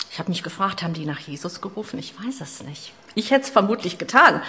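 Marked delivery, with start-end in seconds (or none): none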